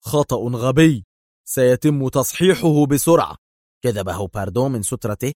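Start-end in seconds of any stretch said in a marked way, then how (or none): 0.1-3.3 curt and sharp, a little louder; clipped sharp retort; sharp reply. A narrator's reading. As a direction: snap the mistake away. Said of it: "أجابه دار في حدة".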